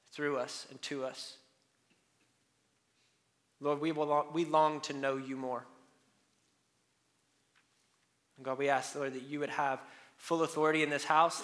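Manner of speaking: soft, low opening prayer. Acted. Pastor praying softly over the church before preaching. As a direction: pray quietly over the congregation.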